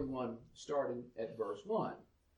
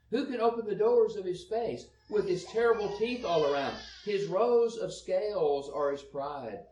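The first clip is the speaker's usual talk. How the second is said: raised, higher Bible reading voice; scripture reading; 4 semitones higher, 6 dB louder than usual. Acted. Preacher reading Job to the congregation, raised and declaiming. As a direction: read the verses out with force.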